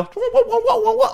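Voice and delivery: comic high repeated wobble; imitated sinking pedal. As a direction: sound out a useless pedal flopping down.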